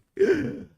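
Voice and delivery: sudden high gasp of realisation; sharp gasp. Real, not acted. Streamer catching her own slip, high gasp. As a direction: gasp as the blunder hits.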